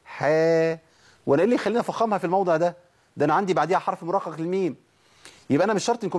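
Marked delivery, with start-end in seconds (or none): 0.0-0.8 حا with a thin, smiling front vowel; unthickened حا, towards "hae"; light ح with smile. Shown as a demonstration. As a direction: smile slightly and hold a light حا. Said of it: "الترقيق بابتسامة".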